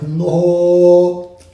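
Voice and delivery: loud flat refusal; firm loud no. Retold or imitated. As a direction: shut it down with a loud no.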